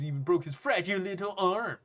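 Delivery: high, loud mocking sing-song; exaggerated high-pitched mockery; mocking bully. Bully mocks a weak victim.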